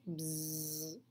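low, soft mower buzz. Acted. Lawnmower buzzing low. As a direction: hum like a mower.

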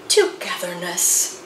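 a low, contented, sighing "Togetherness"; sighed. Acted. Moose savours the coming reunion, sighing the word. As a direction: breathe "Togetherness" out on a happy sigh. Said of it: "sighed the Merry Moose".

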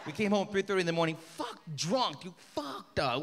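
ordinary delivery

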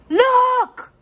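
high, loud exclamation; crying out. A narrator's reading. Rory alerting the others in the tunnel, crying out. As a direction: call it out sharply, high and loud. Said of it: "Rory cried out".

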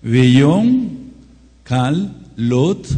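"Veyón" loud and falling, then "Kal Lot" with a held L; careful Hebrew reading. Shown as a demonstration. Teaching the class to read "Kal Lot" syllable by syllable. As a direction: spell out "Kal Lot" as two words. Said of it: "En la fonética dice".

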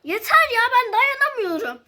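high, loud cry of exasperation; fed-up shout. Pretend. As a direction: yell that you can't take it. Breaking down in acted quarrel.

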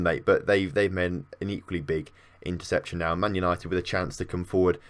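ordinary talk, nothing marked

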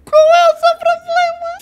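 high squeaky exasperation; exasperated squeal. Real, not acted. Gamer to his character, squeaky exasperated squeal.

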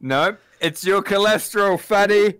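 loud mocking jab at the fat guy; loud teasing. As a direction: shout the insult with a grin. Teasing Big Goosey loudly.

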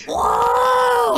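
high yelped whoa; droid's startled whoa. Acted. GM as droid seeing a red balloon, yelped.

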